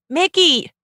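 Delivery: lightly hammed-up buddy chatter; playful pretend voice. Playful pretend talk to monkey.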